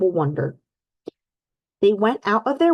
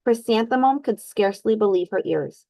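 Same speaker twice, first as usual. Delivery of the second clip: ordinary delivery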